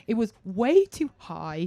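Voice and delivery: usual speaking voice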